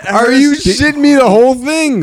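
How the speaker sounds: high, drawn-out incredulous outburst; exasperated disbelief. Real, not acted. Co-host asked for the interesting part of the movie, exasperated disbelief. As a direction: pitch way up, stretching out the disbelief.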